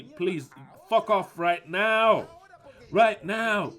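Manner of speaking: loud, drawn-out furious dismissal; angry outburst. Real, not acted. Preacher orders embarrassed listeners to leave, angry and loud.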